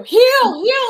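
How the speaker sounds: high, loud dog command; calling out. Retold or imitated. Dad shouting at dogs dragging him down the street, loud high call. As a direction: yell it sharply at runaway dogs.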